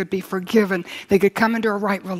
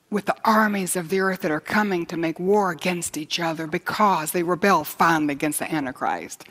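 ordinary delivery

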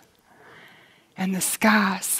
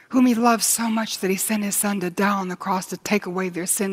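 ordinary talk

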